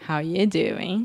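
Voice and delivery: slow, drawn-out sitcom flirt catchphrase; slow pick-up line, lifting at the end; Joey. Acted. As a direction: stretch it out like a New York charmer. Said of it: "Pode até dar uma de Joey".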